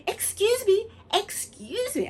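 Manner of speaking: urgent interruption. Acted. Interrupting the fairy.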